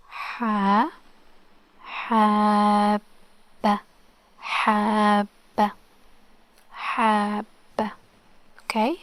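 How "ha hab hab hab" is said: slow syllable-by-syllable "hab" with a held b; doubled b, drawn out. Shown as a demonstration. Teacher reading it for learners, slow doubled b in "hab". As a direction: hold "hab" long, then close on a doubled b. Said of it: "Let's try to read it".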